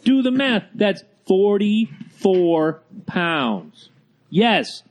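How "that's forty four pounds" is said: slow, weighty, every word stretched; drawn out for emphasis. Real, not acted. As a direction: let the number land hard and slow. Dramatic math on drug weight.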